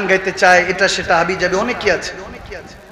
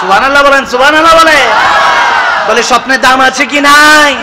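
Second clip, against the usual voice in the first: high, loud preacher's demand; stretched, ringing call-out; rousing crowd call; 6 semitones higher, 9 dB louder than usual. Real, not acted. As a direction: shout for the congregation's response. Rouses crowd for response.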